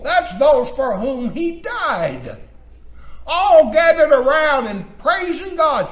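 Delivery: high-pitched, ringing pulpit delivery; exultant preaching. Acted. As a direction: proclaim it to the congregation with rising force.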